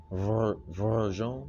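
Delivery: put-on Verzon drawn out slowly in a light Russian accent; a hint of a Russian accent, Verzon stretched out. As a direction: imitate the Russian lady saying Verzon, slow and careful. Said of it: "I'm trying to do the little, use a little accent again".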